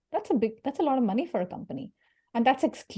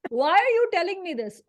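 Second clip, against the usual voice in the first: put-on pissed Indian accent; 9 semitones higher than usual. Annoyed at a LinkedIn stranger, scolding him in an Indian accent. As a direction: snap back in an Indian accent like a stranger spammed you. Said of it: "my Indian accent comes out when I get pissed".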